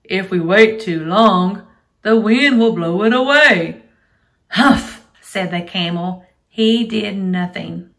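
loud, lively storybook reading; reading to a toddler. Acted. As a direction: read aloud to small children. Teacher reads story to kids.

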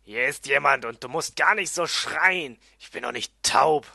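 loud, irritated dwarf; genervt. Acted. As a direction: snap back loudly at someone shouting. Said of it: "sagte er genervt". (laut und genervt)

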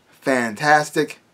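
normal talking voice

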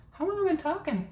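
high surprised question; suddenly realizing the time. Real, not acted.

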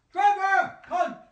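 man screaming at a smart speaker that won't answer; angry shout. Real, not acted. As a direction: bellow the name in frustration. Yelling at unresponsive speaker.

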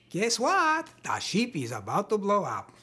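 put-on playful, sing-song Russian-accented announcement; funny Russian accent. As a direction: ham it up for laughs in a Russian accent. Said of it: "could you make it funny?".

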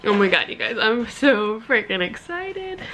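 ordinary delivery

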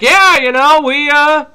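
a high, eager, lightly voiced diplomat; Ben Franklin. Franklin telling the French we won, high and eager. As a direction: boast to the French with a grin in the voice. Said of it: "Ben Franklin shows up and he's like".

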